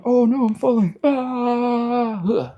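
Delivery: high, loud hammed-up cry of alarm; mock panic falling. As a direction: yelp as if you're toppling backwards. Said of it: "and you start going".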